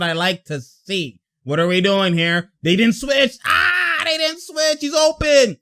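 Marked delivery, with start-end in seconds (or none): 3.5-5.6 high, drawn-out yelp of alarm; alarmed outcry. Real, not acted. Fan reacting to the missed switch, high alarmed cry.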